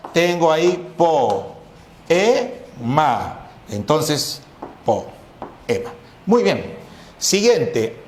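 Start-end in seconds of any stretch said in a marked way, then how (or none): 0.1-3.5 "po, e, ma" split with pauses between syllables. Shown as a demonstration. Teacher syllabifying poema for class, pausing. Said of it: "al momento de silabear". 4.8-5.9 "po, e, ma" repeated with clear breaks; syllables repeated. Shown as a demonstration. Teacher repeating poema syllables for class, paused. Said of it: "al momento de silabear".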